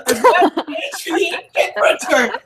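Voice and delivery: high, laughing correction. Real, not acted. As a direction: laugh through it.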